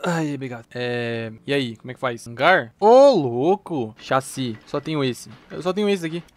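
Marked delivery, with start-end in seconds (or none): 2.8-3.9 drawn-out amazed. Real, not acted. Amazed by game screen.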